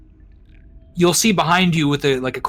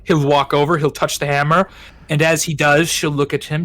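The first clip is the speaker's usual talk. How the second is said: ordinary talk, nothing marked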